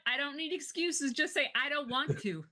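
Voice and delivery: high, heated mock rant; mock scolding. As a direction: scold him like a fed-up parent. Mock scolding of a lazy cohost.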